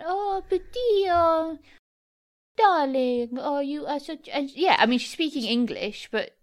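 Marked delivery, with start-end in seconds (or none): 0.0-4.3 lightly put-on French accent, higher and slow. Duchess the cat cooing in a mock French accent. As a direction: coo like a posh French lady, unhurried. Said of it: "speaks with a French accent".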